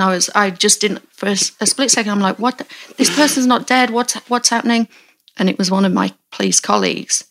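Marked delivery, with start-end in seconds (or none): 2.4-4.9 higher-pitched, mildly panicked confusion; retold startled disbelief. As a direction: replay a moment of baffled shock. Retold shock at moving body.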